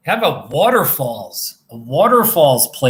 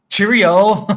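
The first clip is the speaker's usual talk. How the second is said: loud, high British farewell; badly faked British accent, called out brightly; mock British accent; 6 semitones higher, 7 dB louder than usual. Streamer teasing British viewers, mock British accent. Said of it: "I would try to do a British accent".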